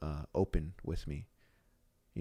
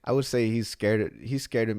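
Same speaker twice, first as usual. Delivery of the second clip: laughing; 7 semitones higher, 9 dB louder than usual. Real, not acted. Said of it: "The laugh".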